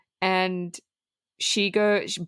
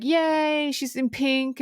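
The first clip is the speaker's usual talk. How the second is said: higher, sarcastic little cheer; sarcastic yay, pitched up; mock cheer; 5 semitones higher, much slower than usual. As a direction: parody the girl's sudden glee. Mocking girl's sudden change of heart.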